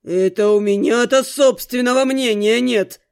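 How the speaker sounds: low, affronted man's voice; indignant male character, looming over her; looming angry question. A narrator's reading. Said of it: "нависая огненной скалой, спросил".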